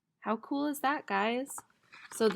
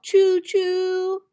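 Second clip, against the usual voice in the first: high, loud choo choo; loud train call; train whistle; 8 semitones higher, 6 dB louder than usual. Shown as a demonstration. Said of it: "trains shout".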